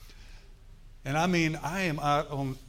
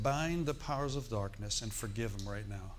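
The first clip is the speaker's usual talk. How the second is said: inner voice; 5 semitones lower, 6 dB quieter than usual. Retold or imitated. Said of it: "there's this inward voice inside me that said".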